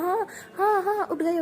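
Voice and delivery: high, crying, flustered yes-yes; jolted awake by dad's shout, panicking; startled panic. Acted. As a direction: blurt it out scared, voice cracking up high.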